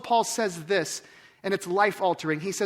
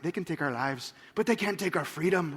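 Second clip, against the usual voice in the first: mock Scottish brogue; 4 semitones lower, 4 dB quieter than usual. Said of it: "You've, you've actually practiced, like".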